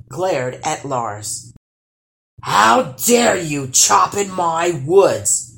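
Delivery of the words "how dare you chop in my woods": loud, slow, drawn-out roar; troll's roar. A narrator's reading. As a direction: roar it at an intruder, stretching the words. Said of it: "roared the troll".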